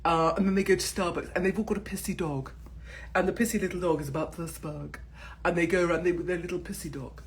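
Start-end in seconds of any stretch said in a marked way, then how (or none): none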